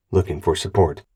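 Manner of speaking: ordinary delivery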